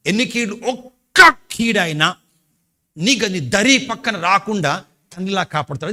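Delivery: loud, high, drawn-out preaching; preaching at full force. Acted. Pastor promises the congregation protection, fervent loud preaching. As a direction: proclaim God's protection with fire.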